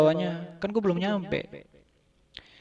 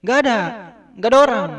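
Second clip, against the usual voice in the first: voice from inside; 7 semitones higher, 6 dB louder, much faster than usual. Retold or imitated. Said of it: "Dia bilang".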